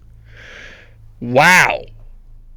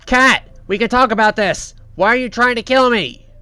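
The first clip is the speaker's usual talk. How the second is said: raised, higher pleading yell at a pursuer; retold yell; 8 semitones higher, 4 dB louder than usual. Coil yelling at Kat who chases him, mock yell. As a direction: call out to Kat, higher and louder. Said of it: "he yells".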